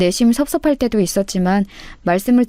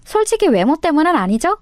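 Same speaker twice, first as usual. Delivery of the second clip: sneering question, higher; mocking jab; 7 semitones higher than usual. Sister-in-law needles the husband, high and sneering. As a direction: needle him about her looks.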